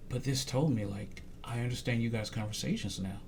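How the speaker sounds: soft, low quote of a realization; retold devastated pastor. Pastor telling his friend he now understands, subdued. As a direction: voice his words quietly and low. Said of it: "this devastated him".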